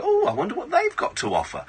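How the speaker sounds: high, cooing mock curiosity; self-mocking old tart, voice lifted high; nosy old tart. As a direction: pitch it right up, playfully intrigued. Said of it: "I'm kind of an old tart".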